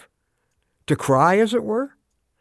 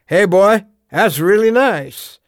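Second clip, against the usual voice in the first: loud, higher big-brother taunt, drawn out at the end; mocking big brother; 4 semitones higher, 6 dB louder, slower than usual. Big brother to little builder before the kick, loud mock praise. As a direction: call it out loudly, stretching the last words. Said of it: "if he had come in and said".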